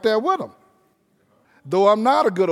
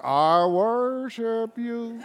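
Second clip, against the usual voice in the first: softer, very slow, reluctant and laughing; grudging mumble; 2 semitones higher, 5 dB quieter, much slower than usual. Pretend. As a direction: mumble it like you don't mean it. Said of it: "You're gonna be saying".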